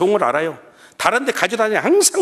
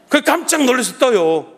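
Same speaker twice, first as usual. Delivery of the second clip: usual speaking voice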